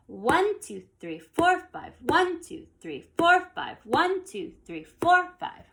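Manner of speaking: One and four punched high and loud, the other beats dropped; five-eight count with loud, high accents on One and four; accented counting. Shown as a demonstration. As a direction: pound One and four like a drummer. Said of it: "my counting here is gonna feel like this".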